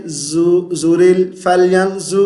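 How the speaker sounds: فَلْيَنظُ with a thin z, "falyanzu"; wrong z sound. Shown as a demonstration. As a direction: say زُرِل as "zorel", a light z for the heavy ظ. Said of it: "وا کو زا پڑھ دیتے".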